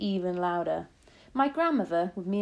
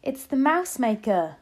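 put-on higher, louder boy crying out; excited cry; 5 semitones higher, 6 dB louder than usual. A narrator's reading. Boy cries out to his grandmother, excited. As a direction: call it out as a thrilled discovery. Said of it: "I cried".

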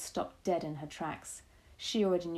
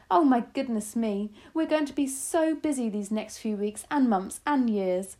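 louder, higher gleeful exclamation; crying out; 4 semitones higher, 5 dB louder than usual. A narrator's reading. Grandmother thrilled at work ahead, crying out. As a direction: marvel aloud with mounting energy. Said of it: "she cried".

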